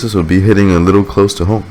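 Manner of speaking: usual speaking voice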